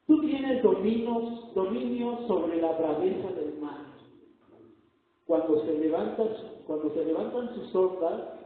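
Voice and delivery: loud, ringing preacher's proclamation; full-force preaching. Acted. Preacher booming the sermon point to the congregation. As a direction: proclaim it to the whole church.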